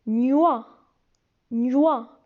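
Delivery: Gnoa said as ny plus wa; syllable demo. Shown as a demonstration. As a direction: blend Gnoa clearly. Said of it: "se prononcent gnoa".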